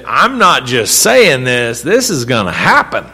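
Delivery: "saying" and "happen" punched loud; emphatic. Acted. God convincing Abraham, emphatic, with "saying" and "happen" hit hard. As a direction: hammer "saying" and "happen", slow and forceful. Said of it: "to be emphatic".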